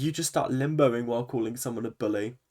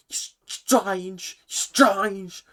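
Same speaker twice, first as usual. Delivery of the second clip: mock thick Liverpool accent, higher and louder; 8 semitones higher, 6 dB louder, much slower than usual. Mocking the housemate's favourite insult in a thick Liverpool accent. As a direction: drag out strange in a heavy Scouse twang. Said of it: "He's got a horrible accent".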